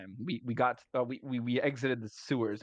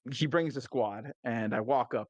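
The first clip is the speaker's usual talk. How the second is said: usual speaking voice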